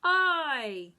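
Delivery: loud heroic shout; superhero voice. Shown as a demonstration. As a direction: blast it out like a superhero. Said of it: "say in a superhero voice".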